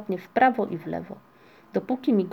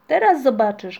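loud, slightly raised threat in play; shouted challenge; 4 semitones higher, 8 dB louder than usual. A narrator's reading. Włóczykij bumps clouds in a game, shouting. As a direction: call it out as a challenge. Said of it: "krzyknął włóczyki".

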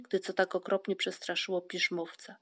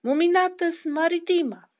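high, loud proclamation; ringing, raised announcement; shouting; 10 semitones higher, 9 dB louder than usual. A narrator's reading. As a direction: shout out the Latin name. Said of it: "krzyczał Paszczak".

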